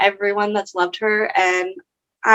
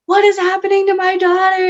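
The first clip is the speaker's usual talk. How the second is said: higher, frantic retold mom's question; panicked mother; 9 semitones higher, 3 dB louder than usual. The mom calling in the night, retold panic. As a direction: relay the mom's alarm, pitch up.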